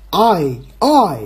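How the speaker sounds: I drawn out slowly, falling; demonstrating English I. Shown as a demonstration. Teacher's sound drill recalled, slow emphatic I. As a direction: stretch each I as a full diphthong. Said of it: "那个I就是I，I".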